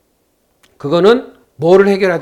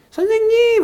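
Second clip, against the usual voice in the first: 선생님! called much higher; raised excited call. Retold or imitated. Caller phoning the teacher back, voice raised high. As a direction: pitch 선생님! way up, overjoyed. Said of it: "이게 목소리가 올라가 있더라고요".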